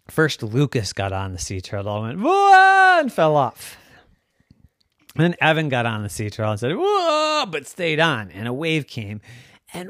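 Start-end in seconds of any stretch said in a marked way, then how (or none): 2.2-7.5 high, loud whoa. Acted. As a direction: cry out as you wobble. Boys on turtle.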